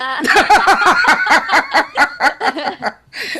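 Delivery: drawn-out amused laugh; real laughing fit; long laugh. Real, not acted. Host laughing at the guest's Red Sox move.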